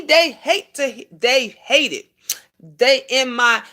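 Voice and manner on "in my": normal talking voice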